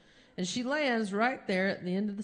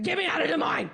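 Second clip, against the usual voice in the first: higher, urgent demand; desperate plea; 6 semitones higher, much faster than usual. Retold or imitated. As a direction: beg to be driven away now. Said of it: "I said".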